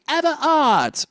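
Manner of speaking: French accent, "heart" with no H. Shown as a demonstration. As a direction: drop both H's in "have a heart", French-style. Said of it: "drop the H so it'll be".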